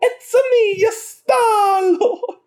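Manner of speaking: very high, drawn-out cartoon plumber voice; Mario. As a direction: squeal it out in a stretched falsetto impression. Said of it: "I will do an impression of his most famous character".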